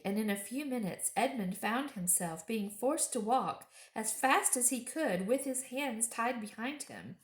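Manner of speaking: ordinary delivery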